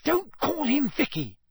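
high, furious outburst. A narrator's reading. Hermione furious, storms off after it.

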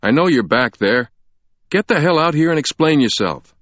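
loud, high-pitched angry order. Acted. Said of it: "a man's voice said angrily".

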